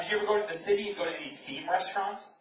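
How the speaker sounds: usual speaking voice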